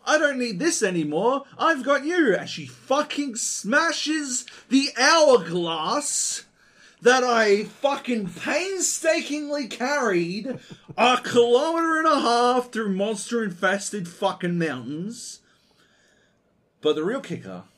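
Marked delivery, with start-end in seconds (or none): 0.0-2.4 squeaky, louder imitation of the girl; mock her in a high gushing voice; high girlish impression. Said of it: "And she's like". 2.4-15.4 slow, high, loud indignant rant; rising incredulous outrage; outraged. Real, not acted. Outraged retelling of smashed hourglass.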